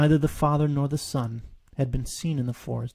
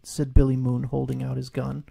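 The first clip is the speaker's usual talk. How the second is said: ordinary delivery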